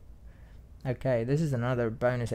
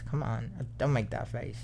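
normal talking voice

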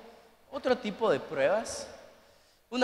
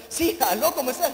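higher, alert phone greeting; wide-awake answer; 4 semitones higher, 3 dB louder than usual. Shown as a demonstration. Pastor picking up after practicing, acting wide awake. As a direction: hide the sleep and sound lucid. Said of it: "contesta todo lúcido".

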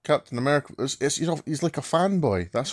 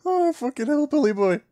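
mocking squeal; 12 semitones higher than usual. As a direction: pitch "Oh, fucking hillbilly boy" way up like a jeer. Repeats the mockery after laughing.

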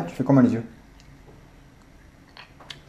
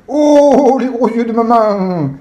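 mock angry mom eyes; 9 semitones higher, 6 dB louder, much slower than usual. Playing mom's angry face.

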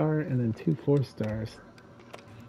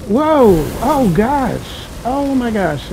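startled exclaim; 7 semitones higher, 6 dB louder, much slower than usual. Real, not acted. Sudden enemy fire in game.

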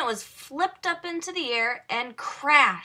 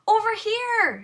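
high, loud call across the water; shout; 11 semitones higher, 4 dB louder than usual. A narrator's reading. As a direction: call out to someone far away. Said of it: "shouted some bathers".

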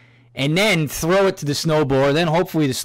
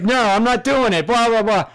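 put-on higher, louder, snotty kid voice; dismissive kid; 8 semitones higher, 4 dB louder than usual. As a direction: brush him off like a bratty teen. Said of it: "and he's like".

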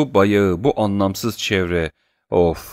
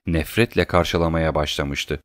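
plain reading voice

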